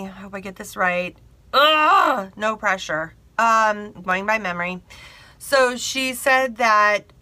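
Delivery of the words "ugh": loud, higher groan; nervous groan. Real, not acted. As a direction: groan under the pressure. Groans at pressure of retelling.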